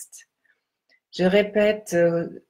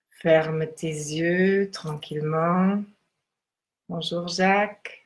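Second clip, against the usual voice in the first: guided meditation. Acted. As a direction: guide them gently into stillness.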